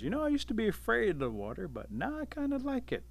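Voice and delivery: high-pitched, easygoing voice; high, mild animal character voice; contented Otter. A narrator's reading. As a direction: lift the pitch way up, not the volume. Otter speaks contentedly to Bear.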